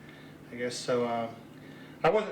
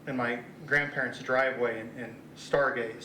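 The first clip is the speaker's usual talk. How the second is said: normal talking voice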